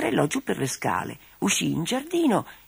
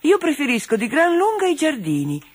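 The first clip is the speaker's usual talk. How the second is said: higher, scornful sniff at the woods; dismissive doll; 10 semitones higher, 3 dB louder than usual. Acted. Doll Dora scoffing at the woods to her toy friends, higher and dismissive. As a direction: brush the woods off with disdain.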